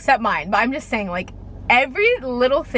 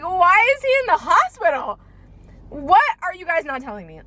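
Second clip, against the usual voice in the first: higher, rising alarm on the hospital line; panicked question; 6 semitones higher than usual. Retold or imitated. Reliving the FaceTime call, panicked high question. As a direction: voice jumps up in fear. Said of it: "I'm like".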